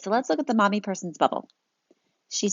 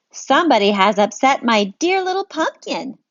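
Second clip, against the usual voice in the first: louder protective mom; a mom defending her child; louder protective mommy; indignant mommy; 5 semitones higher, 5 dB louder than usual. Acted. Mommy voiced in story.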